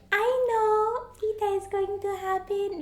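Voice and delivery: high, drawn-out voice imitating mom; mom's knowing reply. Retelling mom's FaceTime reaction, high drawn-out imitation. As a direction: sing it out slowly, high, as mom.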